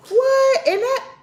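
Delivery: high, loud laughing what; excited squeal. Real, not acted. Vlogger showing off nails, squealing with a laugh. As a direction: burst out laughing in delight.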